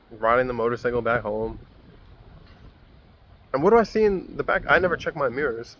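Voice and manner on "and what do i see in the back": ordinary talk, nothing marked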